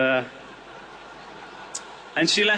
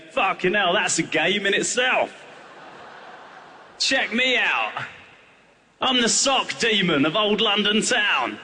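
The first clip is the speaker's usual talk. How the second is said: put-on boastful kid; 4 semitones higher, slower than usual. Kid boasting with his socks.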